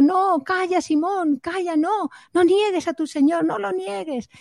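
high, urgent pleading without volume; an inner voice crying out in protest, held down; muffled inner cry. Retold or imitated. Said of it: "Pedro dentro de él gritando". (grito interior, agudo y contenido)

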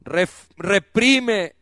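fiery preaching. Acted. Preacher scolding Rachel for refusing comfort, fiery preaching. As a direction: drive it home to the congregation.

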